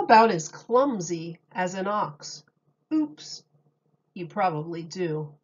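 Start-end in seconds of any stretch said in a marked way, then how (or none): 2.9-3.4 high little mock oops; a playful startled oops. Reader reacting to a clumsy spill, mock surprised.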